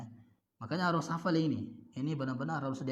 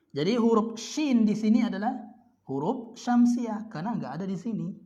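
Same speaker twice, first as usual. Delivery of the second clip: louder and higher, driving the point home; emphatic; 7 semitones higher, 7 dB louder than usual. Real, not acted. Teacher stresses the rule to students, louder and higher. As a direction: lift your voice to stress the rule.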